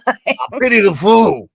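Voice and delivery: Mister T impression. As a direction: play the tough guy, only half-committed. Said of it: "You should do Mister T".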